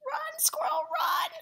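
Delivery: high, urgent hushed cry; urgent whisper-shout. Acted. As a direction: urge it to flee, breathlessly. Reader urging squirrel to flee.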